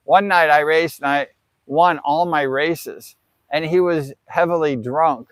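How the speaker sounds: usual speaking voice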